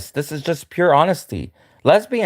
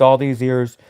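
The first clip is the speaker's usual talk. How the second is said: ordinary delivery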